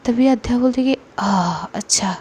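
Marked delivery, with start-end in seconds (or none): 1.2-2.2 breathy whisper of disbelief; whispered aside. Acted. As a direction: let it slip out under your breath. Hurt friend realizes betrayal. (फुसफुसाते हुए)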